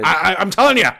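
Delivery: high, loud insistent yell; excited shout. Acted. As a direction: insist at the top of your voice.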